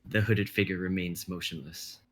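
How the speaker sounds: measured dramatic narration; stage directions read. Acted. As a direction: set the scene like a script reading.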